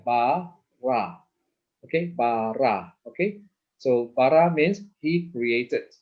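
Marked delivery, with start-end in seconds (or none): 0.1-1.2 BA, then RA, as two separate syllables. Shown as a demonstration. Teacher showing two syllables here, syllable by syllable. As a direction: break it apart: ba ... ra. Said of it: "two syllables here".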